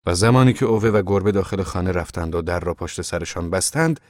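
ordinary delivery, nothing marked

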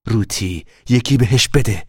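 mother's firm warning; mild threatening tone. A narrator's reading. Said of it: "لحن تهدیدآمیز مادر". (مادر با لحنی کمی تهدیدآمیز)

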